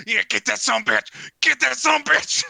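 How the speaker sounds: high, loud spectator's yell; egging on. Acted. Woman in the crowd eggs fighter on, yelling. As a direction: cheer the attack on, rising to a shout. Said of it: "Another chick goes".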